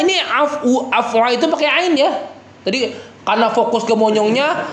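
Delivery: ordinary delivery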